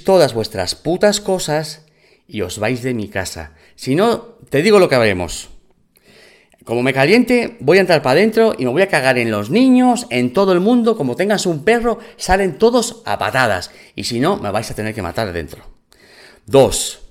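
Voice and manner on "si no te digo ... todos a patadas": louder, higher furious threat; shouting a threat at the squatter woman; retold shouting threat. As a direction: raise the voice as if threatening at the door. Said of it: "ya estoy voceando".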